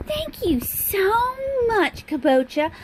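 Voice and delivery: gushing Southern thanks, high and slow; hammed-up Southern drawl, high-pitched and stretched out; fancy Southern accent. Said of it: "I'm speaking in a Southern accent".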